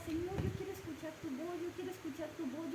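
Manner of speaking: soft, pleading prayer; quiet prayer. Acted. Praying missionary pleading with God, softly. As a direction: beg God softly to hear him.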